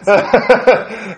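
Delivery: short amused burst of laughter. Real, not acted. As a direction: laugh at the irony. Laughing at the story.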